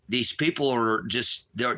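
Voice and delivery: ordinary talk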